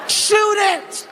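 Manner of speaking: high barked command "Shoot it."; soldier's order. Retold or imitated. Soldier ordering Kevin to shoot, high barked command.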